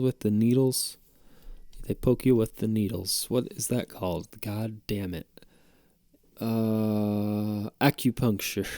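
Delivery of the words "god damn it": usual speaking voice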